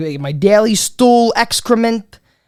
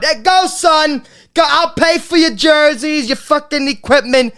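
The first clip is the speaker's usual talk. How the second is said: mock sports dad; 6 semitones higher than usual.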